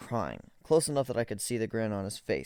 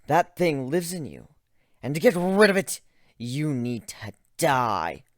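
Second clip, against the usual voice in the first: menacing; 5 semitones higher, 8 dB louder than usual. Acted. Cold threat building.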